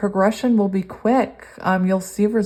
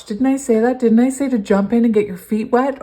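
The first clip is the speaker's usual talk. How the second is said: louder, higher, amazed exclamation; excited; 4 semitones higher, 6 dB louder than usual. Real, not acted. Reader amazed the card matches her words, excited. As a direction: marvel that the card agrees. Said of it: "I'm freaking out a little".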